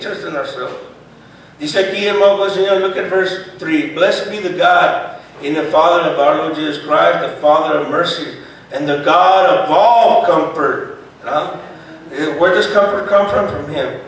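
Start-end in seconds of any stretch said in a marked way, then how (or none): 3.9-11.0 loud, emphatic preacher's Bible reading; scripture reading. Acted. Preacher reading verse three to the congregation, loud scripture reading. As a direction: proclaim the verse with force.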